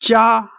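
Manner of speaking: Jia held high and level; high, unwavering Jia; first tone. Shown as a demonstration. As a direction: say Jia on one flat high pitch from beginning to end. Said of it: "let me read it".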